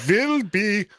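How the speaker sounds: put-on very slow, drawn-out German accent with a rolled R; mock-German announcer dragging out every word; terrible German accent. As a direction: say "will" as "vill" in hammy German. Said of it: "you put a V in instead of a W".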